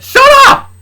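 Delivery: very high, loud bark; angry shout. Acted. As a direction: scream it to silence her. Angry dad silences Luna.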